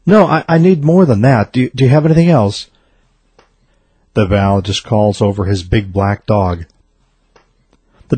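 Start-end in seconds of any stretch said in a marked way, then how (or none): 0.0-2.7 desperate pleading. A narrator's reading. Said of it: "the worried man".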